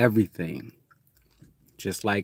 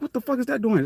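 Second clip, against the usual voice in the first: higher, startled 'what the fuck'; mock surprise; faster than usual. Seeing something new for the first time, higher and startled. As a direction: react like you spotted something odd.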